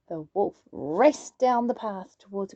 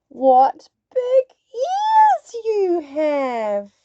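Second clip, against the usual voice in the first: very slow, high and louder; slowly; 6 semitones higher, 6 dB louder, much slower than usual. Acted. Little Red marvels at Granny's ears, very slowly. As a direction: savour each word, wide-eyed. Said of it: "she said slowly".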